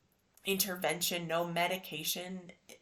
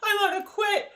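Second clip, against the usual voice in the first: high loud wail of quitting; high, loud imitated cry of despair; mock wail; 14 semitones higher, 12 dB louder than usual. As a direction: cry it out like giving up. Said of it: "I was like".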